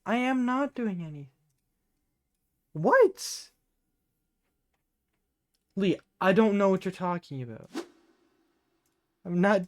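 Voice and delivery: defensive denial. Acted. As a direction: shout your innocence. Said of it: "acting so strange".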